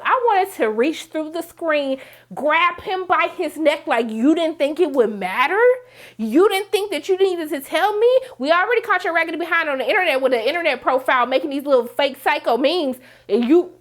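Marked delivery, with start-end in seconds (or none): 4.1-13.7 heated, raised-pitch telling-off; mad. Real, not acted. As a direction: let real irritation drive a rush of accusing questions. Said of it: "I'm mad right now just thinking about it".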